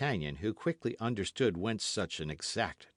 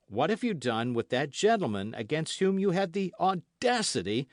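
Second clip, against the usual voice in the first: loud, higher interrogation; stern threat; 7 semitones higher, 6 dB louder than usual. A narrator's reading. D'Artagnan interrogating the host, loud stern threat. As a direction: press him hard.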